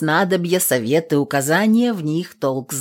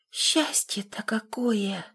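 drowsy, breathy murmur; sleepy whisper; 4 semitones higher, 10 dB quieter, slower than usual. Acted. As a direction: murmur it half asleep. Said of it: "сонно пробормотала Лукаша". (сонный шёпот)

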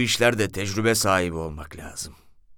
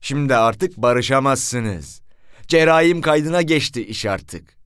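slow, loud official verdict; stern refusal; 5 semitones higher, 5 dB louder, slower than usual. Retold or imitated. Commissioner refusing to let them reconcile, slow stern verdict. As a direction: lay down the law slowly.

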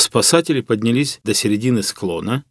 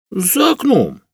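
high-pitched simple answer; naive peasant; 9 semitones higher, slower than usual. A narrator's reading. Hunter answers the puzzled professor, naive peasant.

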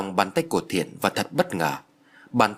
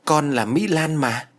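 higher, slow, drawn-out objection; mildly put-out correction, pitched up; indignant protest; 5 semitones higher, slower than usual. A narrator's reading. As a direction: protest with mild indignation, stretching the words. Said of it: "cô nàng dãy nảy".

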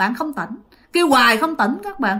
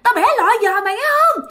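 ghost yelling; 11 semitones higher, faster than usual. Retold or imitated. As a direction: shriek after the fleeing victim, half laughing. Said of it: "bà này la làng ở phía sau lưng".

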